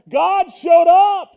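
slow, loud, drawn-out proclamation; preacher's booming declaration; emphatic shout. Real, not acted. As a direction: proclaim it with full force. Preacher building to climax.

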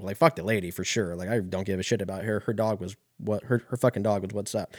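ordinary talk, nothing marked

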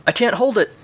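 high-pitched mock panic; panicking. A narrator's reading. Luke struggling on his Death Star approach, high-pitched panic. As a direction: raise the pitch as if losing control. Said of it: "Luke started to panic".

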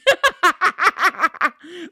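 long, high peal of laughter; loud, helpless cackling laugh; real laugh. Real, not acted. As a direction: crack up at the absurdity. Laughs at mom FaceTiming her.

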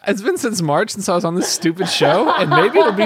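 mock your British friend's accent in mild complaint; Seamus. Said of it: "making fun of my accent".

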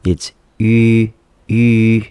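ü as E with puckered lips; lips pursed. Shown as a demonstration. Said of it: "if I say E and I pucker my lips".

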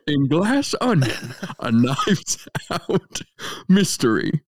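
put-on film trailer read in a Savannah Southern accent. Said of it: "practice a Southern accent".

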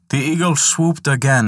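ordinary delivery, nothing marked

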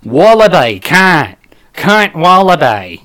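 Australian trigger words, vowels broadened; Australian accent. Shown as a demonstration. Comedian finds the accent with trigger words, Australian accent. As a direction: warm up a rusty Australian accent. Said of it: "trigger word".

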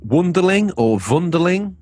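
Wonderling first plain, then Wunderling with a German V sound; English then German take: Wonderling, Wunderling; German pronunciation. Shown as a demonstration. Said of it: "depends if you're German or not".

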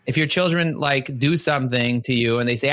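normal talking voice

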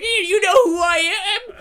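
high, wailing comic tantrum; outraged man-child squawking loudly; hammed-up sputtering star meltdown; Adam Sandler. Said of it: "And Adam's like".

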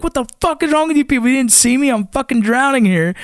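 high-pitched mock scream, easing off; retold screaming. Nearly drowned soldier berating his teammates, retold screaming. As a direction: act out an indignant scream without full volume. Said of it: "And I was screaming".

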